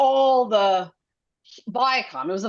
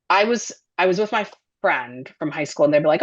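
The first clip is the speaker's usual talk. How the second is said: ordinary delivery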